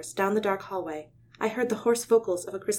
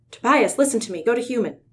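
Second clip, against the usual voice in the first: higher, louder urgent plea; urgent urging; 4 semitones higher, 8 dB louder than usual. Acted. Leader urges his injured friend to go human, higher and louder. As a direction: push him to change back, loud.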